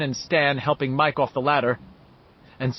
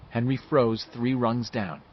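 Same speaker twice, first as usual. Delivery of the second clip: plain reading voice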